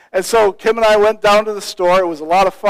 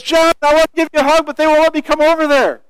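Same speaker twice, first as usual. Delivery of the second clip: high, raised-voice imitation of a woman calling; calling across a distance; 8 semitones higher, 4 dB louder, faster than usual. Friend calling to the preacher across police gates, shouting. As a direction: call over the barricades to a friend.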